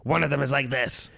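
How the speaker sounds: a hint of a growly scare actor; lightly voiced scare actor; scare performer voice. Shown as a demonstration. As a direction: lean into a cheesy haunt voice. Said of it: "two scary voices".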